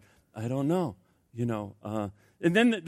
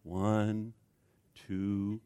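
low, soft slow count; quiet counting; 7 semitones lower, 8 dB quieter, much slower than usual. Shown as a demonstration. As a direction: count it under your breath. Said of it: "count to ten".

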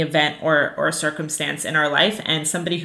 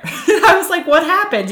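frustrated, laughing; 12 semitones higher, 5 dB louder than usual. Retold or imitated. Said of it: "I was so frustrated".

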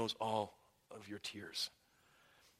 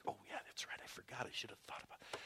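breathy hushed whisper, mock sheepish; 14 dB quieter, faster than usual. Pastor owning up to a church member, whispered. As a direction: whisper it like an embarrassed admission. Pastor voices himself forgetting.

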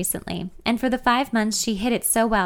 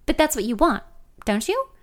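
higher, worried question; 7 semitones higher than usual. A narrator's reading. Friend checking she wants marriage, worried. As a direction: ask anxiously, rising at the end. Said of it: "more worried than usual".

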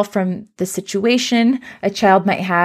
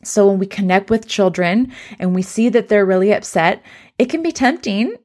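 ordinary delivery

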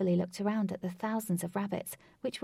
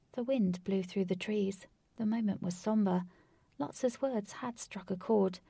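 plain reading voice

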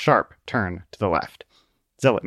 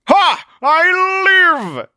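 very high, loud exultant shout; triumphant cry; 8 dB louder, much slower than usual. A narrator's reading. Zealot crawls from the wreckage, triumphant high cry. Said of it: "cried Zealot".

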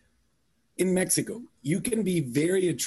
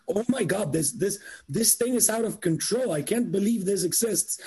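higher, stunned, can't believe it; recalling the shock of first seeing it; retold amazement; shocked disbelief; 6 semitones higher than usual. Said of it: "I was like".

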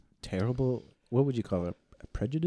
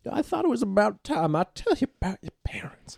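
whispering grandma; 8 semitones higher than usual. Pretend. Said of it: "I'm his grandmother".

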